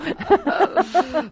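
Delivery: loud high laughter. Real, not acted. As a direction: burst out laughing. Laughs at host.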